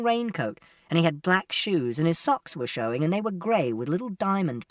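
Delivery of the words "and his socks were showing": usual speaking voice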